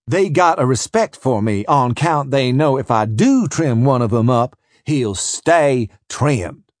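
ordinary delivery

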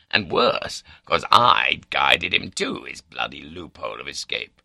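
usual speaking voice

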